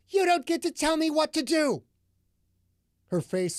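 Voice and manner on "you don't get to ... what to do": high, loud furious yell; angry outburst. A narrator's reading. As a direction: snap back at him in rage. Delilah erupts in anger.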